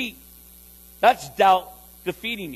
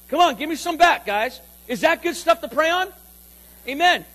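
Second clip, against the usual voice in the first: louder, rousing the room; calling for response; 5 semitones higher, 6 dB louder than usual. Real, not acted. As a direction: get them to answer back. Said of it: "Is this, guys, this on?".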